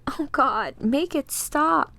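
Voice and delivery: anguished cry. Acted. Dinah pleading as the attack overwhelms her, anguished. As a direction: stretch out the plea in agony.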